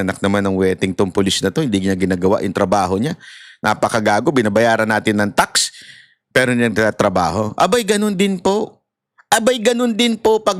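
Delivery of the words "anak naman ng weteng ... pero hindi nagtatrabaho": much lower, quick angry muttering; low grumbling rant. Retold or imitated. Angry citizen ranting about a useless policeman, low and fast. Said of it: "Sasabihin natin".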